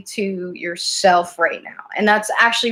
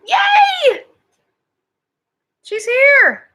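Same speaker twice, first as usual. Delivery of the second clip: high, loud squeal of delight; excited cheer; 5 dB louder, much slower than usual. Real, not acted. Reader spots the missing viewer, excited cheer. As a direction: shriek it happily, way up high.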